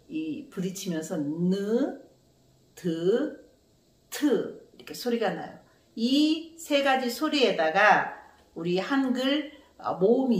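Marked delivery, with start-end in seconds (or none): none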